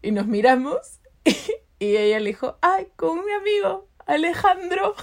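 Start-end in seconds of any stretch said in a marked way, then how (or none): 2.6-4.9 high, slow fib with amusement; mock-innocent lie. Girl on the phone hiding who she kisses, mock-innocent lie. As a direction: draw it out high, playing innocent. Acts friend lying on the phone.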